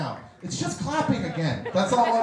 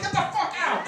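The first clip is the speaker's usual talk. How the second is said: much higher mock yell; a high, mock angry outburst; 12 semitones higher than usual. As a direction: throw them out in high outrage. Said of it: "I'm like".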